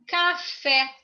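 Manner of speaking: café split in two, the final "fé" stressed; stress on the last syllable. Shown as a demonstration. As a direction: pronounce "café" as separate beats, ca-FÉ. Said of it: "acentuada na última sílaba".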